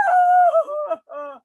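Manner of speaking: put-on terrified girl begging; frightened wail. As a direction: plead in tears like a scared child. Child frightened of losing soul.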